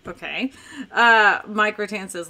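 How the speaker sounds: ordinary delivery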